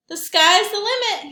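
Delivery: high, loud boastful call. Acted. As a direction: call it down from the sky. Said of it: "he called".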